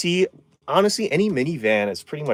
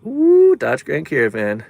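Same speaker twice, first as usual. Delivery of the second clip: admiring ooh; 5 semitones higher, 6 dB louder than usual. Acted. Admiring a viewer's van.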